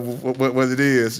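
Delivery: ordinary talk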